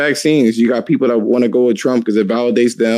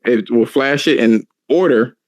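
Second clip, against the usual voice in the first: usual speaking voice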